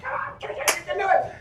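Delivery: high, strained mock scream, held back in volume; squeezed, high-pitched cheer from the sidelines; imitated screaming. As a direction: scream encouragement without full volume. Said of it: "I start screaming".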